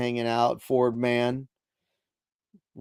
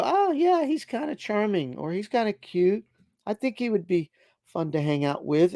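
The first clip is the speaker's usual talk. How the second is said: high, dreamy imitated crush talk; swooning voice; 8 semitones higher than usual. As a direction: imitate someone swooning over a guy. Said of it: "maybe go".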